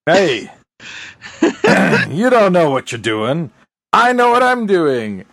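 high, insistent mock protest; military Magoo bluster. Host replaying the Colonel's argument, blustering mock insistence. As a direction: argue back in hammed-up indignation. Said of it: "good old, uh, military Mc-, Magoo".

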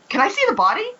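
eager, a bit too keen; mock eager to see the body; creepily excited. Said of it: "He sounded way too excited".

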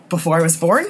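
ordinary delivery

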